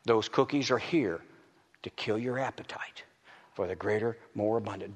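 secretive sermon whisper; hushed whisper. Acted. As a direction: drop to a whisper. Preacher drops voice to whisper.

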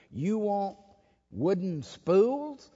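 high, slow, disbelieving question; incredulous question. Retold or imitated. Electric company man asks the pastor, incredulous. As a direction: stretch out spools in disbelief.

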